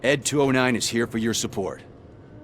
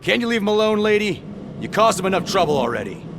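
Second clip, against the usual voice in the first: irritated rebuke; 10 semitones higher, 4 dB louder than usual. Acted. Officer rebuking the reporter.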